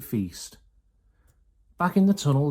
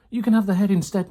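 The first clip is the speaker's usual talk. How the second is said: higher, louder calling down the stairs; bossy call; 8 semitones higher, 7 dB louder than usual. A narrator's reading. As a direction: fling it down the staircase. Mrs Bean calling down impatiently.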